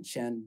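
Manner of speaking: SHEN said "shun", low and soft; quiet demonstration of SHEN as a word ending; suffix pronunciation. Shown as a demonstration. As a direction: say SHEN as one short word-ending syllable. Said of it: "this is the way we pronounce it".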